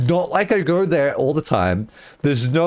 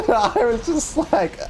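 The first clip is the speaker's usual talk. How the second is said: laughing; 13 semitones higher than usual. Real, not acted. Laughing while retelling.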